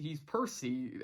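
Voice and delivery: normal talking voice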